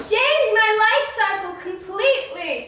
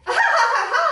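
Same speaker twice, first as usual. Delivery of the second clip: laughing at her own joke; hammed-up ha ha ha; forced laugh; 6 semitones higher, 4 dB louder, much faster than usual. Patient laughing at her own diabetes joke. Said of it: "I'm so funny".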